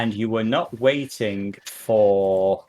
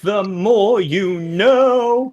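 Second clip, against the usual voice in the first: mock announcer; 9 semitones higher, 6 dB louder, much slower than usual. Announcer catchphrase parody.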